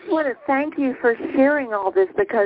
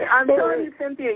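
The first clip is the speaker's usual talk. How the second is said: ordinary talk, nothing marked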